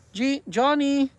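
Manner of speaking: high, drawn-out pet call. Real, not acted. Owner looking for the cat Johnny, calling out.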